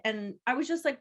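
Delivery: ordinary talk, nothing marked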